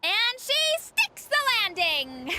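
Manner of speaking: slow, high sports announcer call; mock announcer. Announcing her own perfect landing, mock announcer. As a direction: proclaim your own landing like a commentator.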